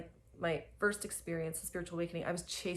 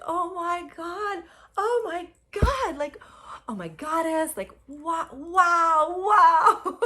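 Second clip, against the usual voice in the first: high, rising cries of wonder; overwhelmed wows; ecstatic exclaiming; 10 semitones higher, 9 dB louder, slower than usual. Retold or imitated. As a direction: gasp it out in rapture. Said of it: "And I was like".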